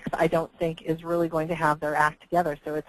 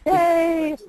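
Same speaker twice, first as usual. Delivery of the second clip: excited cheer; 12 semitones higher, 6 dB louder than usual. Real, not acted. Relieved connection works.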